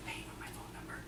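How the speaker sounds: a knowing little whisper, nudging him; hushed whisper. Retold or imitated. Turning back to the clerk, whispering a sly hint. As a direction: whisper it slyly over the shoulder.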